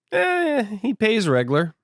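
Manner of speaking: high-pitched shrugging reply; dismissive. Retold or imitated. Coffin shrugging off Ishmael's warning.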